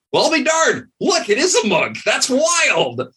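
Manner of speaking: high, amused disbelief; lightly voiced surprised soldier; amazed guard. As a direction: gawk at the monk in wonder. Guards surprised to find monk.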